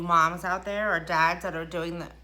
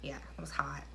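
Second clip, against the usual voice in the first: whispered joke; 4 semitones lower, 8 dB quieter than usual. Real, not acted. Joking aside after bad shot.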